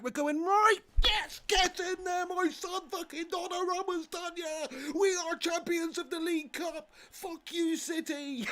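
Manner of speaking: high-pitched gleeful crowing; euphoric celebration. Real, not acted. Streamer celebrating the cup win over City, high euphoric crowing. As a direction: gloat like you just won the final.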